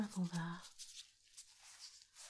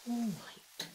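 usual speaking voice

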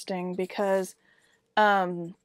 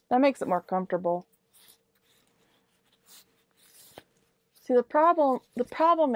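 muffled behind cloth mask; speaking while adjusting mask; through a face mask; 4 semitones higher than usual. Real, not acted. Seen 2: Fabric mask covers mouth and nose through most of the words.

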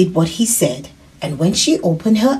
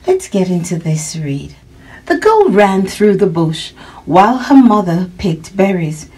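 ordinary delivery, nothing marked

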